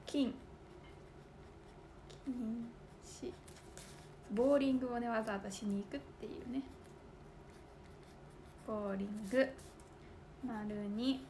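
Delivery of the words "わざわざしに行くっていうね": fast whispered aside. Real, not acted. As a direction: mutter it under your breath quickly. (早口のささやき)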